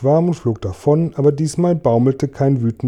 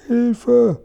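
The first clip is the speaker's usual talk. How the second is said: high frightened yelp; panicked cry for help; 10 semitones higher than usual. A narrator's reading. Narrator voicing centipede, panicked high cry. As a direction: centipede crying out in panic as peach falls. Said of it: "schrie Tausendfüßler".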